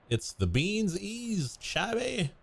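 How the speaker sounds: high, slow goofy mispronounced greeting; a hint of a silly cartoon voice. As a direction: mangle the name playfully.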